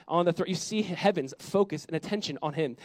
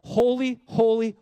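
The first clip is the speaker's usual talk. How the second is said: higher and louder angelic cry; 4 semitones higher, 6 dB louder, slower than usual. Acted. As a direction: cry each holy out to the heavens. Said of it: "they cried out to one another".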